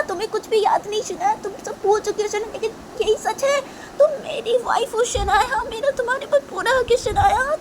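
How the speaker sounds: high-pitched, insistent begging; pleading Tisha. Acted. Tisha begging Shanaya to remember their marriage, pleading. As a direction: implore in a high voice, repeating the name.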